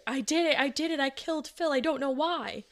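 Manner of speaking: high-pitched imitation of a hysterical confession; mock screaming sobs. Wife confessing at the bedroom doorway, imitated screaming and sobbing. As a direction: imitate a distraught wail, pitched well up. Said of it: "Bryn started screaming and sobbing".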